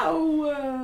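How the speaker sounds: loud, high cry of protest; wrestling shout. Acted. Character pinned in a wrestling match, shouting. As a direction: yell it while grappling.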